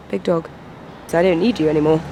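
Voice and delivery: ordinary talk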